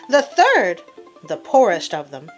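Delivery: plain reading voice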